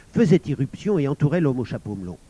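ordinary delivery, nothing marked

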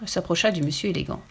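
ordinary delivery

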